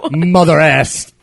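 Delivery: ordinary talk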